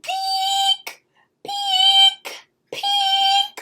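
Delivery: very high peeping alarm; alarm chime. A narrator's reading. Alarm clock going off, high peeps. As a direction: peep like a tiny alarm. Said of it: "went my alarm".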